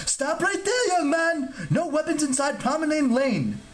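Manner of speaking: very high-pitched, outraged god; high, indignant telling-off; indignant shriek. A narrator's reading. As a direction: shriek orders like an offended rule-keeper. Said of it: "the god shrieked indignantly".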